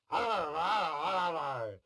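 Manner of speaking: slow, high mock growl; growling lawyers. Lawyers snarling at each other over rights, mock growl. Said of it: "three batches of lawyers going".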